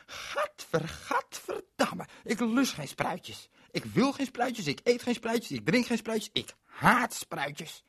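very high, slow, loud disgust; childish tantrum. Acted. Berend refuses the sprouts, high and loud tantrum. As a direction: stamp your feet in words. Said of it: "En hij werd ontzettend boos".

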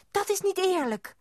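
put-on high, loud childish protest; indignant protest. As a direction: cry out at the unfairness. Child protests refusal of stamp.

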